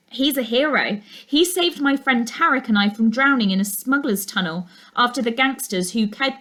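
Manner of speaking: usual speaking voice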